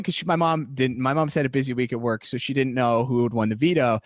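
ordinary talk, nothing marked

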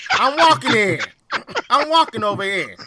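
put-on loud, high Brooklyn accent; hammed-up Brooklyn accent; 1950s Brooklynese. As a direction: bark it like a Brooklyn cabbie crossing the street. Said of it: "nineteen fifties Brooklynese".

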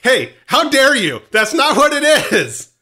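hammed-up offended shouting; mock outrage. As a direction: scold the co-host in fake shock.

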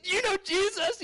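very high, eager question; high-pitched joyful blurting; overjoyed. Retold or imitated. Reenacts joyful question to nurse.

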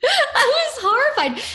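high-pitched, laughing through the words; amused outrage breaking into laughter. Real, not acted. Telling friends of being teased at camp, laughing in mock horror. Laughing at own embarrassing story.

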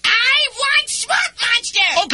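put-on whiny kid yelling his demand; demanding shout.

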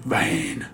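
soft, drawn-out "van"; imitated pronunciation. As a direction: draw van out in a husky imitation. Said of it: "That's how he says it".

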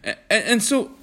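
high, indignant outburst after a stammer; accusing cry. A narrator's reading. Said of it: "cried John accusingly".

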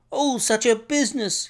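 high-pitched, horrified exclamation; distressed cry. A narrator's reading. Frantic doctor greeting Holmes at his door, high distressed cry. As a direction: cry out, aghast at the shocking business. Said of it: "he cried".

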